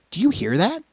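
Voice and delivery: high, small rabbit voice; light bunny voice. Acted. Rabbit character in story.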